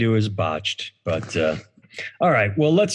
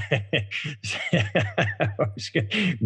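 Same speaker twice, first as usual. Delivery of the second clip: quiet laugh; 3 semitones higher, 5 dB quieter than usual. Real, not acted. Laughs at own joke.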